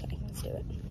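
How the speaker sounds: soft unvoiced whisper. Real, not acted. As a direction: keep it to a hush. Whispering inside a quiet store.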